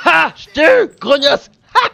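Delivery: high, loud victory yell; explosive gloating cries; triumphant shout. Real, not acted. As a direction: crow loudly over a beaten enemy. Boss beaten.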